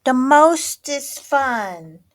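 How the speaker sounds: put-on high, loud, drawn-out little-kid voice; gleeful little sister. A narrator's reading. Third kitty sister outdoes her sisters, gleefully drawn out. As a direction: top the others, stretching every word. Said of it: "added Jujubee".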